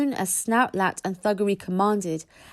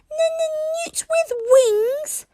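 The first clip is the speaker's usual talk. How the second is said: even higher, slow, near-crying squeal; outraged stuttering dragon; 4 dB louder, much slower than usual. A narrator's reading. Toothless repeating the insult louder, crying squeal. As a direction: wail it, deeply offended. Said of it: "repeated Toothless".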